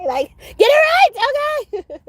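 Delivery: high, loud laughing squeal; a giddy high-pitched tease. Real, not acted. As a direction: squeal it playfully at the spirits. Laughing joke at God's team.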